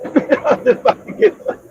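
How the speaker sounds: real laugh breaking into the line. Real, not acted. Joking about going to get caramel popcorn, laughing.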